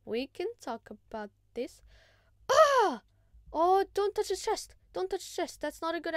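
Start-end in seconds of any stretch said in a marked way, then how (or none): 2.5-3.0 sharp high startled yell, loud; a short cry of shock as he is hit; loud sudden "ah" of alarm. Real, not acted.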